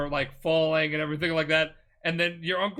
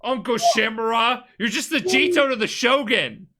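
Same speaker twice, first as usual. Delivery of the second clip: higher, louder, shouted at the uncle; furious yelling; 10 semitones higher, 7 dB louder than usual. Retold or imitated. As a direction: yell it like a rebellious nephew. Player shouting at uncle in quarrel.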